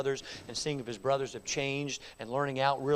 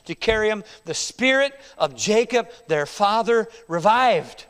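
loud, higher, proclaiming the verse; preached scripture reading; 6 semitones higher, 10 dB louder than usual. Acted. Preacher reading Genesis to the congregation, loud and exalted. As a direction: read it out like a proclamation. Scripture read at full force.

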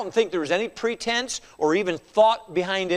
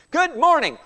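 cheery greeting; 9 semitones higher, 4 dB louder than usual. Retold or imitated. Cheery greeting to grumpy person.

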